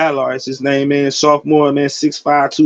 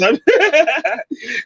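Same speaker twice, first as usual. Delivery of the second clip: sudden high burst of laughter; real high-pitched laugh; 10 semitones higher than usual. Real, not acted. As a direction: let a high cackle escape. Laughing at his own joke.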